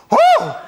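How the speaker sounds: very high, loud startled "oh"; hammed-up falsetto exclamation; startled old woman's cry. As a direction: imitate an old woman's shrill gasp. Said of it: "she went like this".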